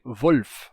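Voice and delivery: "Wolf" com L e F colados; pronúncia padrão. Shown as a demonstration. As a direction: say "Wolf" as one syllable, no vowel between L and F. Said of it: "os falantes não vão dizer". ("Wolf" numa sílaba só, como no alemão padrão)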